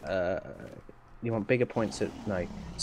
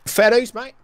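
high, loud burst; loud concession; 10 semitones higher, 12 dB louder than usual. Real, not acted. Gamer conceding to his mate, loud high exclamation.

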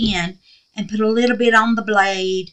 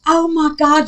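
usual speaking voice

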